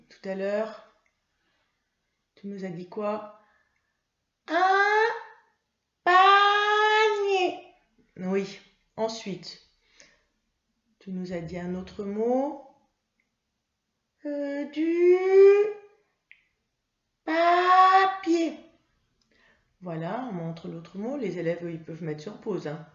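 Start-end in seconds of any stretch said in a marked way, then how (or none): 4.5-7.6 put-on much higher, louder, slow child puppet voice; Mimi. Mimi the puppet answering her teacher, high child voice. 14.3-18.5 put-on much higher, louder, slow child puppet voice; Mimi. Mimi the puppet answering her teacher, high child voice.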